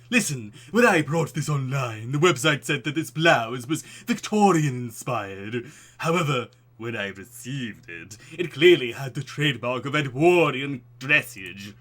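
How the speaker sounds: loud, slow, theatrical horror-film gentleman; pompous old-world grandeur, booming; Vincent Price voice. Acted. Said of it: "my Vincent voice".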